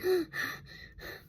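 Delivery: a sharp, high, mock gasp of alarm; imitated gasp. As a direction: gasp as if seeing the cut again. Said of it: "but I was like".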